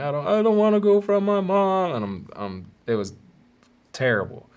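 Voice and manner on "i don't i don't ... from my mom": high, louder mock sobbing plea; crying kid. Kid begging the police chief, high mock sob. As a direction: beg through tears. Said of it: "I was like".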